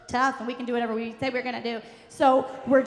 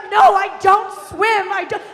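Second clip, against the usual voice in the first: panicked refusal; 7 semitones higher, 10 dB louder than usual. Retold or imitated. Mother refusing to leave the rock, high loud protest. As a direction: imitate a mother hollering her refusal.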